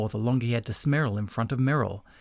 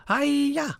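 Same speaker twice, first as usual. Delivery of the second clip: very high-pitched battle yelp; martial arts cry. A narrator's reading. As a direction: let out a mock karate cry. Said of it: "cried Slippery Merrill".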